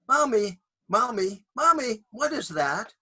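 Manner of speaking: put-on high, eager little kid; child voice. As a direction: ask like a curious small child. Child sees a radio again.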